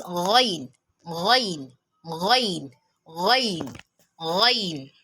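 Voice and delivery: "ghain" with a voiced throat fricative at the start. Shown as a demonstration. Teacher drilling the letter for learners, throaty "ghain". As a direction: roll "gh" in the throat, then glide through "ghain". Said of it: "It is pronounced from the throat".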